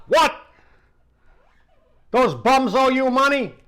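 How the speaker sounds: high, loud outrage; shocked that the bums owe money; outraged disbelief. Retold or imitated. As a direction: Sal erupting at unpaid rent. Sal outraged.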